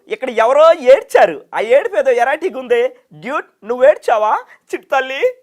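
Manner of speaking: very high, loud, excited comic voice; Saptagiri impression. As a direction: squeal it like a manic comedian. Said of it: "Saptagiri గారు voice imitate చేస్తారా".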